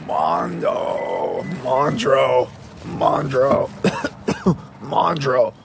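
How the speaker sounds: repeated loud calls; calling out. Real, not acted. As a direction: keep shouting his name. Calling out for Mondo.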